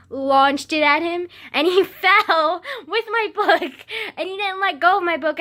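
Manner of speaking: loud, high, laughing through the words; laughing storytelling. Real, not acted. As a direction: crack up while telling it. Laughing while telling chase story.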